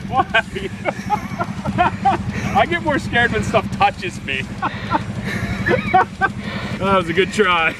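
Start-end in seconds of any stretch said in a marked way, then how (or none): 0.5-2.5 rising giggle; high laugh. Real, not acted. Laughing after the scare. 4.5-6.8 breathy laughter; airy laugh. Real, not acted. Laughing after the scare.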